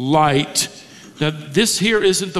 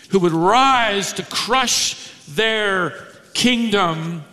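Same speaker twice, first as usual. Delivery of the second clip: ordinary talk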